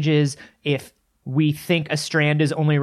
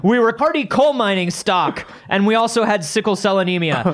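proud family patriarch; 6 semitones higher than usual. Acted. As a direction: boast about the family's sickly heritage like a clan chief. Boasting mock family heritage.

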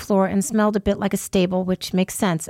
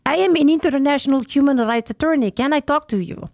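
put-on Slavic accent; 5 semitones higher than usual. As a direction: imitate a pushy man with a Slavic accent. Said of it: "in a Slavic accent".

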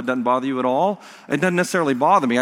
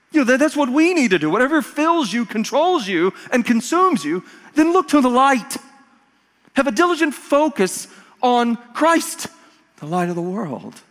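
high, raised exhortation; emphatic preaching; 8 semitones higher, 3 dB louder than usual. Real, not acted. Preacher urging church to look to the light, high emphatic exhortation. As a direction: drive the lesson home from the pulpit. Preacher drives home the lesson.

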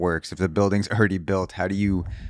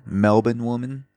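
trying Australian Melbourne; 3 semitones higher than usual. Shown as a demonstration. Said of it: "what's the Australian pronunciation".

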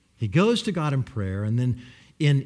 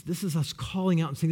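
ordinary talk, nothing marked

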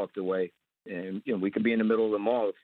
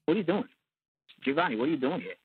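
sudden suspicion; 5 semitones higher, faster than usual. Retold or imitated. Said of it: "His whole demeanor changed".

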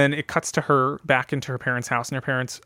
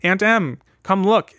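raised, high call to someone nearby; acted summons, louder and higher; 6 semitones higher, 5 dB louder, slower than usual. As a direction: call an aunt over eagerly. Girl calls her aunt over.

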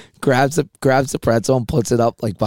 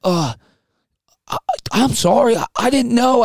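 high, timid stammered apology, much higher; meek apology; 11 semitones higher than usual. Retold or imitated. Bartender stammering his sorry to the crowd, high and timid. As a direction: play the flustered bartender caught off guard. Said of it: "He's like".

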